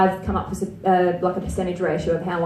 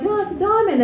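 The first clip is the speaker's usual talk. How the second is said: put-on little girl voice; 10 semitones higher than usual. Niece voiced in story.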